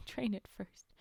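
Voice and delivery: breathless whispered giggle; slow, laughing whisper. Real, not acted.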